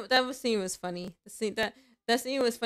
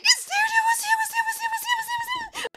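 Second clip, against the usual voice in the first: very high, loud excited shouting; a fan shouts to his fiancée in the cinema; freaked-out shout; 5 dB louder, much faster than usual. Retold or imitated. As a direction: lose it over a surprise cameo. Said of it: "freaked out".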